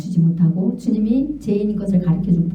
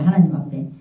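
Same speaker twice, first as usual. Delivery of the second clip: normal talking voice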